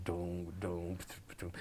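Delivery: low, soft doom doom like a bass pedal; low droning bass. Retold or imitated. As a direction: hum a quiet low pedal tone. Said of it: "the guy goes".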